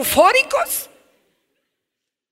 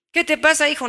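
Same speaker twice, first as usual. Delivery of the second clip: ordinary talk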